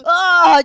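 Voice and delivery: high, loud moan of pleasure; ecstatic shout. Pretend. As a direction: shout it out in mock bliss.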